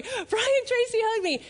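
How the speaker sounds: high-pitched breathless-fan retelling; excited. Retold or imitated. Wife telling her husband the news, high and excited. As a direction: burst out with the big news. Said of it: "I ran upstairs and I told my husband".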